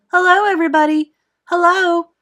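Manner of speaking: high, loud, excited hail; joyful calling out. A narrator's reading. Boy hails the people below, calling out joyfully. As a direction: call down happily to a crowd. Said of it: "laughing and waving and calling out".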